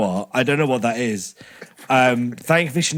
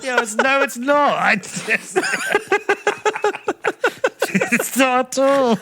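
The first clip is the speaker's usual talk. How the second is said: high-pitched, helpless laughter through the words; laughing protest; 10 semitones higher, slower than usual. Real, not acted. Disagreeing with his co-hosts, helpless high laughter.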